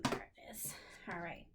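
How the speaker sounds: quiet aside. Real, not acted. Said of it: "I'm looking for my little thing".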